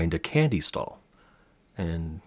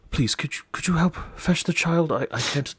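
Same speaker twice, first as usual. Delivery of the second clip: higher, hurried, begging the party for help; urgent plea; 10 semitones higher, 4 dB louder than usual. Acted. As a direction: plead anxiously for a favour. NPC begs party to fetch child.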